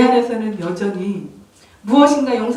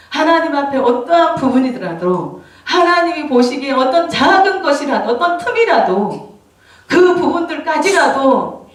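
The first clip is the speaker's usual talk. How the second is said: higher, emphatic preaching plea; impassioned preaching; 4 semitones higher, 4 dB louder than usual. Real, not acted. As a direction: raise the voice and press each phrase. Preaches with raised, urgent force. (높은 목소리로 힘주어 호소하는 설교조)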